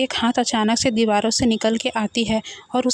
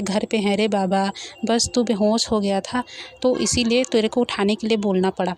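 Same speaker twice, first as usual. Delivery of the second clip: plain reading voice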